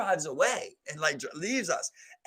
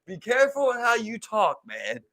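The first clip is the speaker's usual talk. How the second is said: emphatic warning; 6 semitones higher, 6 dB louder than usual. Retold or imitated. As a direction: raise the voice like a cautionary lesson. Lesson voiced as emphatic warning.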